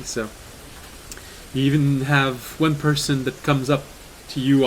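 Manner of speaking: ordinary talk, nothing marked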